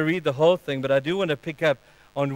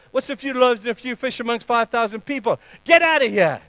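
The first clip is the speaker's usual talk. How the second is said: high, loud, scornful rebuke; shaming disciples; 8 semitones higher, 5 dB louder than usual. Acted. Disciples chase off the boy with his lunch, scornfully. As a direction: scoff and send him packing. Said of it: "the disciples would have laid shame on that little guy".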